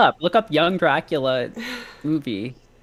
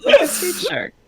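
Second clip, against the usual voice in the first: high, slow, helpless laughing; 12 semitones higher, slower than usual. Real, not acted. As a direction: squeeze the words out through laughter. Cracks up repeating the joke.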